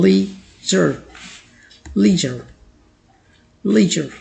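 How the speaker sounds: लीजर as "LEE-zher", first split, then joined; correct pronunciation. Shown as a demonstration. Teacher repeats it for learners, careful correct pronunciation. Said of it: "correct pronunciation कुछ इस तरह होती है".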